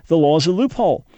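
ordinary talk

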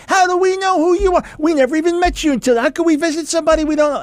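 preacher voicing a pastor yelling back at the meeting; retold indignant shout. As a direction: fire the questions back hard and high. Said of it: "they're yelling at each other".

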